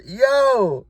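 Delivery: normal talking voice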